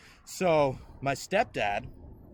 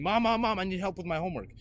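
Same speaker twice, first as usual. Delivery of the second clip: high, pleading schoolkid whine; raised, insistent calls for mom; kid calling for mom; 6 semitones higher, 5 dB louder, faster than usual. Retold or imitated. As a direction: reenact a child pestering mom. Child voiced calling for mom.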